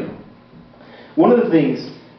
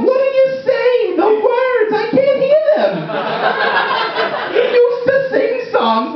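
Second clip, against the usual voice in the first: put-on much higher, slow, scandalised old lady voice; slower than usual. Comic's grandmother grumbling at the Grammys on TV, much higher and slow. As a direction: fuss like a baffled granny at the TV.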